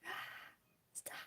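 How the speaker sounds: half-hearted scream at yourself; mock self-scolding scream. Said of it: "This is me screaming at myself".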